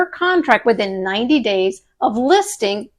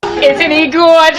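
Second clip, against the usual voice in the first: cooing proud parent; 4 semitones higher than usual. Acted. Cooing over pretend baby.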